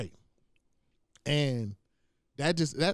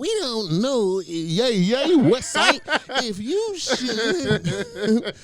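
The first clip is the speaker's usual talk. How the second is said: much higher, slow, louder mock gangster laughing; 9 semitones higher, 6 dB louder, much slower than usual. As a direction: ham up a gang-sign pose, laughing. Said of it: "throwing up gang sign".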